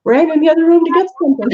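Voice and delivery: gasping the words out after running; out of breath. Real, not acted. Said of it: "I'm kind of out of breath".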